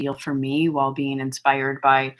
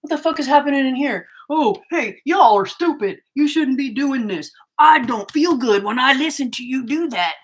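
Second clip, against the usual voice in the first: mocking rude viewers, higher and louder; mock outraged trolls; 7 semitones higher, 5 dB louder than usual. A vlogger mocks trolls who barge into her channel, loud mocking whine. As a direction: ham up a whiny indignant commenter, loud and high. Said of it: "instead of like".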